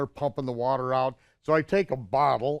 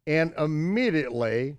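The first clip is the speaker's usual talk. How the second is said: normal talking voice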